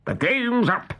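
high-pitched pirate captain's cry; Hook crying out. A narrator's reading. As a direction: announce defeat as the captain. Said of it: "cried Hook".

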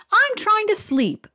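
a seagull woken from its nap snaps back; seagull snapping. Acted. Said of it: "a seagull snapped".

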